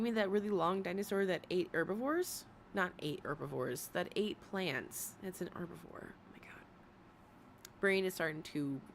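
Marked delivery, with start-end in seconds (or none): 5.2-6.6 much softer, whispered under the breath; whispered aside. Real, not acted. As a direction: hush it as a private aside.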